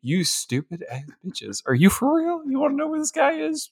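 very high, incredulous squeak; mock exasperation. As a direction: play an exasperated fish in disbelief. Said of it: "the fish is like".

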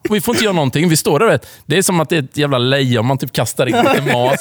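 normal talking voice

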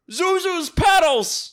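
high, loud, slow drawn-out cry; hammed-up joyful discovery; gasp, then cry it out in mock rapture; overjoyed movie hero.

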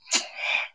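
imitated doctor's heavy sigh; deep breath. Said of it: "he took a deep breath".

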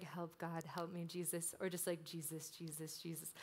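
a quiet plea when overwhelmed; hushed desperate prayer. Retold or imitated. Praying in her mind when overwhelmed, hushed and pleading.

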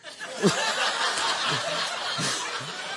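breathy laughter; amused laugh. Real, not acted. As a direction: laugh at the joke. Laughing at his own story.

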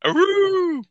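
high howling wolf call. Pretend. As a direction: throw your head back and howl. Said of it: "I can howl too".

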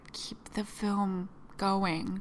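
slow unvoiced whisper. Director whispering to keep the cameras rolling, slow whisper. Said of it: "Deborah Cho was just like".

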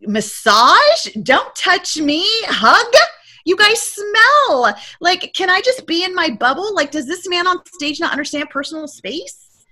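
high, drawn-out indignant recoil; appalled protest. Retold or imitated. Reluctant attendee objects to hugging strangers, appalled. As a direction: act out comic horror at being crowded. Reenacting disgust at seminar crowd.

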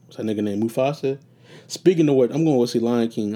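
ordinary talk, nothing marked

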